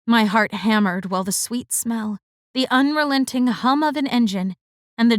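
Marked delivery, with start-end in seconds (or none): none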